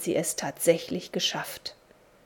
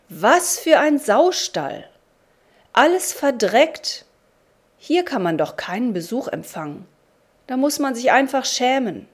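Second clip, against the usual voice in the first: disgusted outburst; 4 semitones higher, 5 dB louder than usual. A narrator's reading. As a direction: scold the dirty house. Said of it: "rief er laut".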